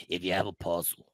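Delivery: put-on low, deep Canadian accent; C T impression. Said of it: "What is this accent?".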